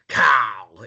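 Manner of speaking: long, drawn-out raspy "Carl". Retold or imitated. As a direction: imitate a cartoon man's rasp, slowly. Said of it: "really sort of raspy voice".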